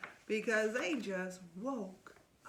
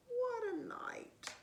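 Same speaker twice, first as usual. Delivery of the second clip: mole muttering; 12 semitones higher, slower than usual. Acted. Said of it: "Mole mutters".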